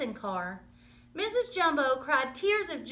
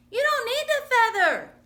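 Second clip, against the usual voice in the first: much higher and louder urgent call; crying out; 11 semitones higher, 7 dB louder than usual. Acted. Mouse calling to falling friend, urgent high shout. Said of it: "cried Timothy".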